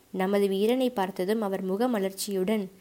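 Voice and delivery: ordinary delivery, nothing marked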